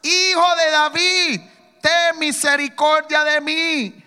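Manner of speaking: slow, loud, drawn-out plea; loud pleading cry. Retold or imitated. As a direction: beg at full voice, stretching each word. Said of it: "él clamaba mucho más".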